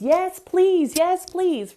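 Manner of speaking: put-on high, loud little-kid voice, arms wide open; toddler yes please. Toddler Ollie welcoming everything, high eager child voice. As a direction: say it like a thrilled three-year-old. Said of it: "open, wide arms".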